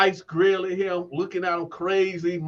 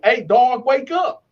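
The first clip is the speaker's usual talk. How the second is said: raised, higher voice to rouse a sleeper; retold firm wake-up call; 4 semitones higher, 4 dB louder, slower than usual. Waking the snoring cellmate on a second try, raised voice. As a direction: imitate calling someone awake.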